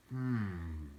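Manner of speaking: soft, thoughtful hum; hat muses quietly; small voice. Acted. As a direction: hum softly as if in his ear. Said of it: "a small voice in his ear".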